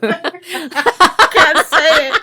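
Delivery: high, loud, drawn-out through laughter; helpless laughing squeal; laughing hard. Real, not acted. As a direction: barely get it out for laughing. Cracking up at own failed word.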